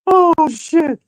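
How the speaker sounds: high, helpless squeal amid laughter; cracking up. Real, not acted. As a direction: say it high, still dying of laughter. Cracking up at own impressions.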